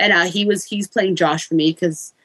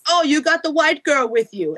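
put-on Jamaican accent; 6 semitones higher than usual. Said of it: "he sounds like a Jamaican".

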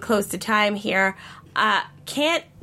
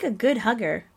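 ordinary talk

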